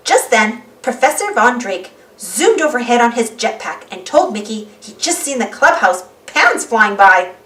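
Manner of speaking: usual speaking voice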